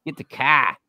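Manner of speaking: "car" as "cah", lightly Boston; a quick Boston "get the cah"; mild Boston accent. Retold or imitated. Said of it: "Boston accent".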